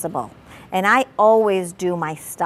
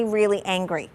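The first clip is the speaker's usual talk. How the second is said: ordinary talk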